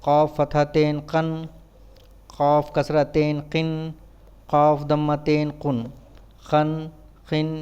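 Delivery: even, monotone syllable-by-syllable drill; measured classroom letter drill; spelling drill recitation. Shown as a demonstration. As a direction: recite it like a spelling chant, pausing between items.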